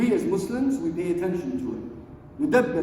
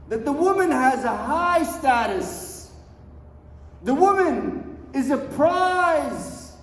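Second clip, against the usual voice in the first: fiery preaching; 6 semitones higher, 4 dB louder than usual. Acted. Imam urging the women of the world, impassioned preaching. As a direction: preach it out, stretching the key words.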